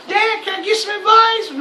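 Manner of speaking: hammed-up high daughter's cry for help; mock pleading daughter. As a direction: squeal it like a daughter begging for advice. Charlotte asking crying Ric Flair.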